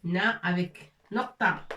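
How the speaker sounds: ordinary talk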